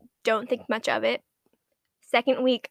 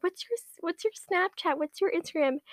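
eager crush; 6 semitones higher than usual. Retold or imitated. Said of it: "and he's just like".

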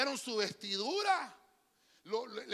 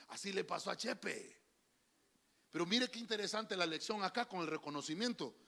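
usual speaking voice

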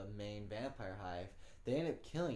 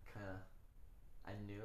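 usual speaking voice

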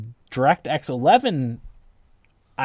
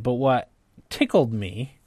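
ordinary delivery

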